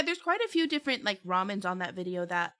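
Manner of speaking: usual speaking voice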